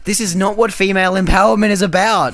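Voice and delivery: high, loud retold indignation; worked-up, incredulous complaint; exasperated protest. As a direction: object loudly, in mock outrage. Retelling own outraged reply to cheering.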